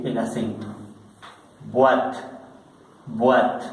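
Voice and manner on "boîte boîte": "boîte" said "bwat", one syllable; French "boîte", the OI sounding like "wa". Shown as a demonstration. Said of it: "que suena una U". ("boîte" pronunciada buat, con sonido u-a)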